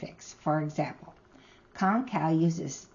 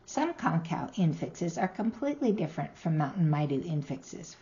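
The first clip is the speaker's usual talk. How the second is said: ordinary delivery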